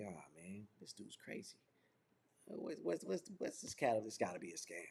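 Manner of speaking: soft, half-whispered disbelief under the breath; skeptical mutter. Retold or imitated. Skeptical New Yorker walking past, soft doubtful mutter. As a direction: mutter it to yourself, unconvinced.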